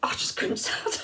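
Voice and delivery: breaking into laughter; real helpless giggle; laughing. Real, not acted. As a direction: laugh through the words. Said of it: "I couldn't stop laughing".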